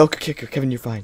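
ordinary delivery

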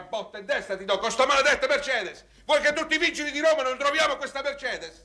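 high, heated rebuke; exasperated scolding. Acted. Friend fed up about missing Mercedes, exasperated. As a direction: lose patience and scold.

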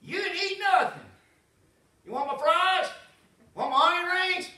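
high-pitched fussing mother; mama fussing. Retold or imitated. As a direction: fret over him like a mom. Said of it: "she's like".

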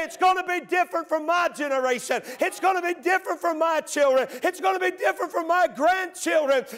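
high, rising declaration shouting the last line; defiant vow. Pretend. Believer vowing to shut the door on sin, defiant shout. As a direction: proclaim it like a family vow. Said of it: "and say".

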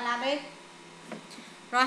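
ordinary delivery

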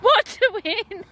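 high, loud playground yell; yelling from the sidelines. Real, not acted. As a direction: shout it across the park. Yelling game across playground.

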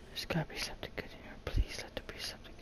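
fast, barely voiced murmur; hushed pleading. Real, not acted. Player hoping to find something good, hushed pleading. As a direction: mutter a quick plea under your breath. Nervously creeping and searching in game.

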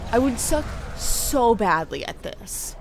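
ordinary delivery

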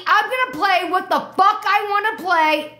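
high-pitched, fed-up yell. Real, not acted. DJ silencing everybody's song requests, yelling. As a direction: yell over everyone to shut them up. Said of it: "Why are you yelling?".

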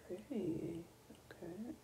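breathy awed whisper. Real, not acted. Gamer whispering over a pretty item. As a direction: whisper it in quiet awe.

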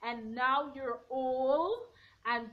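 usual speaking voice

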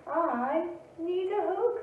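slow, rising plea for a hug; Douglas the bear. A narrator's reading. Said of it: "said Douglas".

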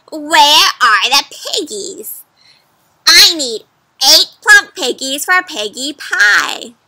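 loud, slow bossy witch; demanding witch. Acted. Witch demanding eight piggies from the cow, loud. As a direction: demand the pigs like a greedy witch.

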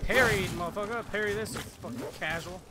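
loud, cocky taunt; gloating trash talk. Real, not acted. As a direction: rub the win in loudly. Trash talk after a parry.

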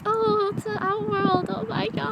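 very high, slow, wonderstruck pet voice; imagined excited pet. Pretend. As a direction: voice an awed little animal way up high. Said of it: "she looks so excited".

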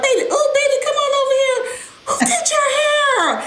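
high-pitched imitation of a fussing auntie; cooing auntie. Aunties call the child over to see the hair, high imitated cooing. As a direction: coo it way up high like a doting auntie. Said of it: "there is this table of aunties".

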